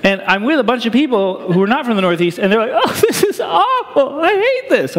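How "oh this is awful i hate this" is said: high, whiny laughing complaint; mock dismay. Preacher imitating teammates hating the rude grocery crowd, high and whiny. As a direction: play the horrified outsider.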